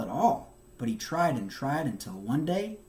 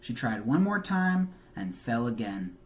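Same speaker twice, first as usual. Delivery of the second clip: plain reading voice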